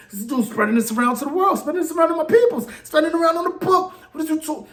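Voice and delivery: high, fast, heated rant; worked up. Real, not acted. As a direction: let the outrage boil over. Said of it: "I'm getting a little worked up".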